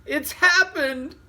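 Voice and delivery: very high, loud, slow alarmed cry; shrill cry. Acted. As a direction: cry out in shock. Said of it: "shrill scream which brought everybody".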